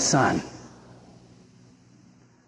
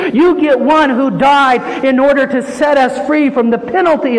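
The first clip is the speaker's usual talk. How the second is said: raised, driving pulpit delivery; forceful preaching; 5 semitones higher, 4 dB louder than usual. Real, not acted. Preacher tells congregation this is no wimpy Savior, forceful preaching. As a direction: pile up the phrases with mounting conviction.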